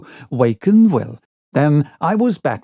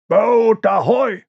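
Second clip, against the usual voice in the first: high, drawn shout across water; hailing; 8 semitones higher, 3 dB louder than usual. Acted. Will hails a boat in the night, shouting. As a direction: hail a far-off boat. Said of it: "raised his hands to his lips and hailed".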